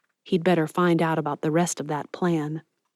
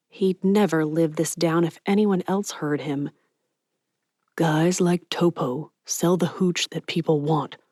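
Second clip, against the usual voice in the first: plain reading voice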